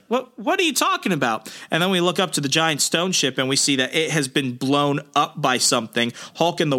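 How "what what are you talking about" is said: high-pitched, stammering imitation of confusion; mock bafflement. Answering a demand to surrender, mock baffled voice. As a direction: act out an incredulous, much higher question. Acting a baffled reply in recap.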